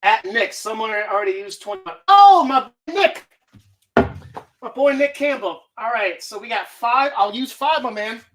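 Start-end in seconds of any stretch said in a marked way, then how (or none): 2.1-5.6 excited greeting. Real, not acted. Viewer picks a movie number.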